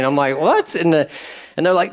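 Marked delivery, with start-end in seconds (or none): 0.4-0.7 much higher, startled "What?"; a quick incredulous yelp; mock surprise. Newly saved preacher reacting to the buddies, high and startled.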